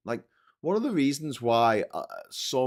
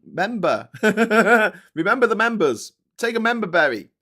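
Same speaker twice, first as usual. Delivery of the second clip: member berries voice; 10 semitones higher, 10 dB louder than usual. Acted. Playful pop-up voice, laughing.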